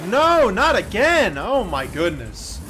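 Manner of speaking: slow dismayed outcry; anguished disbelief. Real, not acted. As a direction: wail in disbelief at the crash. Another crash on track.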